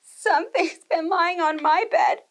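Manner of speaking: a tiny bear, worried and slow; little bear voice. Acted. Little Wee Bear tells his family, small worried voice.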